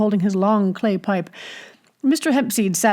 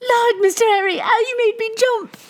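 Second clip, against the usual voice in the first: put-on startled girl. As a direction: squeal it as a flustered barmaid. Startled barmaid Sally cries out.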